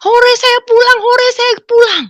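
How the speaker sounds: put-on high, loud childlike cheering; overjoyed high cheer; joyful cheer. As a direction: cheer like a kid going home. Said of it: "Saya gembira".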